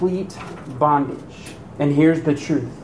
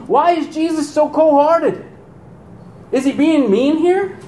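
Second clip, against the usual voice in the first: troubled questioning; 11 semitones higher, 3 dB louder than usual. Retold or imitated. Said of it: "Are you asking".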